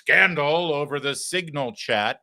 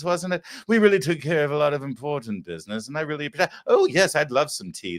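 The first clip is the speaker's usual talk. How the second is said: mock prim, genteel tea-party voice; prim posh lady; 2 semitones higher than usual. Host mimics the congresswoman talking primly in private, genteel. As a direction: play a refined lady ordering tea. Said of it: "That's what she talks like in real life".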